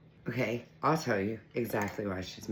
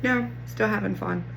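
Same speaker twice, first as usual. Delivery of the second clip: higher, playful cat voice; voicing the cat; 9 semitones higher, 5 dB louder than usual. Owner voicing cat still having fun, cutesy. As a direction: give the cat a cheeky high voice. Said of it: "and she's like".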